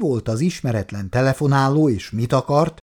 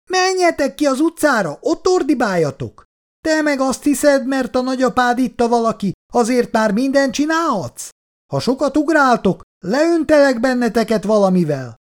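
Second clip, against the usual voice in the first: high-pitched neighbour woman ranting on; angry woman scolding; 9 semitones higher than usual. A narrator's reading. Neighbour woman berates the children from her window, high and angry. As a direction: keep snapping at the kids, voice pitched way up. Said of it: "A mérges női hang tovább csattogott".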